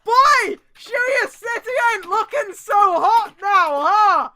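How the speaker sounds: loud, high, slow dramatic taunt; hammed-up character voice. Reading a fanfic aloud to friends, big hammy taunt. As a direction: ham up the villain's gloat.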